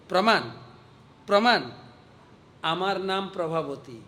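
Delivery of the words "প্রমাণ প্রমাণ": fiery mocking. Fiery gopi seizes on the word.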